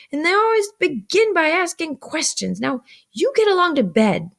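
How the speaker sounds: high-pitched, emphatic tirade; scolding sister. A narrator's reading. Mrs. Joe sends questioning Pip to bed, scolding. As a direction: lecture the child and send him off.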